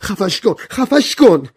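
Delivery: very high panicked shout; screaming. A narrator's reading. Woman begs for the gramophone to be stopped, screaming. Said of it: "ورا فریاد زد".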